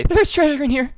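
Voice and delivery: high, loud thrilled cry; excited squeal. Acted. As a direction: squeal it out with glee. GM voices player's treasure sense.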